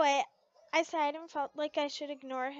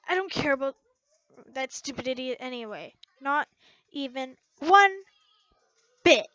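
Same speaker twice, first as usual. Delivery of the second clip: loud denial; 9 dB louder than usual. Acted. Heated denial.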